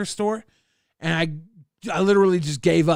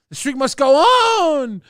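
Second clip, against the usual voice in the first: higher and louder rallying cry; 8 semitones higher, 6 dB louder than usual. Acted. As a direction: proclaim it like a motto. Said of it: "Commissioner Levine".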